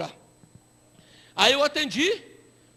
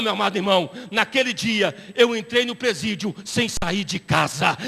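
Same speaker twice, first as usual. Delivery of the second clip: normal talking voice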